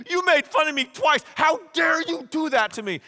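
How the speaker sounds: high, loud mock fury; hammed-up indignant scolding; really mad. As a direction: play an outraged woman telling off her lover. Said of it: "now she's really mad".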